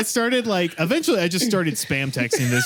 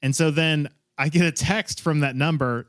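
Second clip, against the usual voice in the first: usual speaking voice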